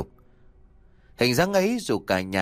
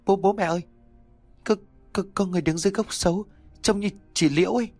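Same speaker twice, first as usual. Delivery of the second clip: much higher, stuttering and rushing in fright; terrified stammer; 8 semitones higher, faster than usual. A narrator's reading. Daughter points out her dead sister's ghost to parents, terrified stammer. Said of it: "lắp bắp bảo".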